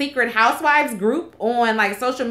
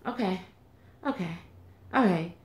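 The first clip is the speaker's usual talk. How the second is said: meek Vicki; 2 semitones lower, 8 dB quieter than usual. Retold or imitated. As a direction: imitate a cowed, deflated reply. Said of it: "And Vicki's like".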